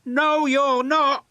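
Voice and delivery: high, loud and slow, firmly; firm puff. A narrator's reading. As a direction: plant each word, refusing to let him give up. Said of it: "puffed James".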